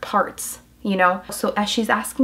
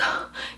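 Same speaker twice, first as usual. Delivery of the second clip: exasperated sigh; 10 dB quieter than usual. Retold or imitated.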